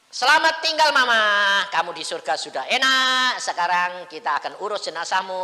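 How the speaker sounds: high, loud proclaimed farewell. Retold or imitated. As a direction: call out goodbye to mother's body. Farewell to dead mother.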